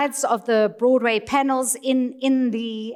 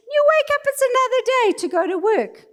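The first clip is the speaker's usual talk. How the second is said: tags 1; 12 semitones higher, faster than usual. Acted.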